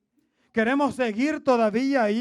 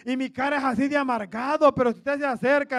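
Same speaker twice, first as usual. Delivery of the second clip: ordinary talk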